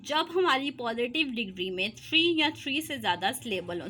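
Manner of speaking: ordinary delivery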